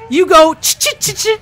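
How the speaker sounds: high gnome demonstrating squirrel chitter; high, staccato squirrel chittering; gnome squirrel-talk. Acted. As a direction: teach squirrel chatter in a squeaky gnome voice. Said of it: "That's not how you say hi".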